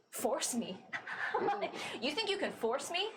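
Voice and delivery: runaway laughing off a threat; scornful Astra. Acted. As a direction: scoff at the idea of being forced.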